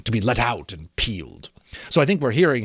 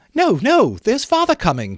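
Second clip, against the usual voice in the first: put-on very high, squealing children's cry; excited children; 8 semitones higher, 4 dB louder than usual. The two young Cratchits greet their sister, high and thrilled. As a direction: shout it like two thrilled little kids. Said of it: "cried the two young Cratchits".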